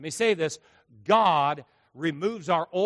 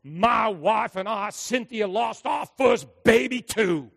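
loud, slow, raised voice; the pastor answering the grieving woman at full volume; booming confession; 5 semitones higher, 5 dB louder, slower than usual. Retold or imitated. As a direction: call it out to her, slow and loud. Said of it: "He said".

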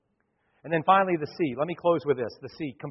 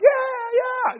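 loud, high crowd yell; crowd cheering; 8 dB louder than usual. Retold or imitated. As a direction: whoop like a wild crowd. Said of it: "everybody's like".

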